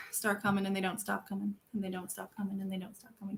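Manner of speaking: trailing off into silence; fading repetition. Pretend. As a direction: let the lyric fade away. Repeats song lyric, trailing away.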